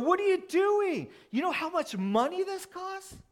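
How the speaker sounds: high, loud, outraged protest; indignant disciples. Retold or imitated. Disciples scold Mary over the costly oil, high-pitched indignant outburst. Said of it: "They're going".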